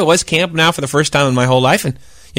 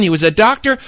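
usual speaking voice

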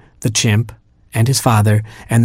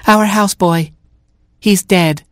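high put-on giraffe voice; giraffe chorus; 9 semitones higher than usual. Giraffes explaining the dead houseboy, high put-on voice. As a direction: answer in a high, matter-of-fact giraffe voice.

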